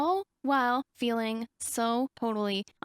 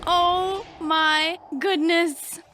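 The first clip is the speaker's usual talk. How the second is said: slow, loud and stretched out; drawn-out disbelief, louder; slow awed oh my goodness; stunned awe; 5 semitones higher, 6 dB louder, much slower than usual. Real, not acted. Reacting to the transformation.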